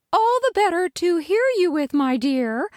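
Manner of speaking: high, put-on granny voice; sweet fake granny; wolf's Granny voice. Wolf to Little Red, high fake granny.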